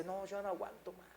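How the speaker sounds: hushed, mildly exasperated quote; voiced weary complaint. Congregant tells the pastor she's had enough, hushed retold complaint.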